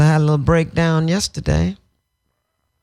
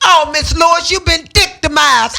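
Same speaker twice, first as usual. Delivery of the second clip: higher, louder, teasing call; mock internet commenter; 9 semitones higher, 3 dB louder than usual. Quoting an internet commenter to the interviewer, teasing. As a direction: ham up the commenter's cheeky line. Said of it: "That son of a bitch said".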